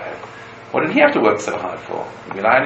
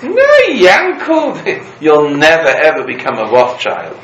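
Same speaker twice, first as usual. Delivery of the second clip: high, loud, slow teasing call then a laugh; a friend needling Yankel as he rushes past; calling over mockingly; 5 semitones higher, 8 dB louder, slower than usual. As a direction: tease him across the street. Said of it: "He calls over to him".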